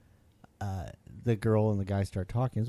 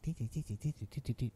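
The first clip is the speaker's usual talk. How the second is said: quiet, mocking dee-dee-dee chatter; soft mock chatter noises; mock babble; 9 dB quieter, faster than usual. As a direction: mimic mindless yammering, softly.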